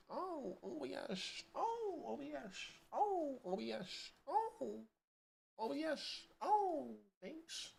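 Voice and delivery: soft high sing-song coaxing; high cooing. Real, not acted. Streamer pleads with OBS to pick up game, high coo. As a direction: coo at the program like a pet.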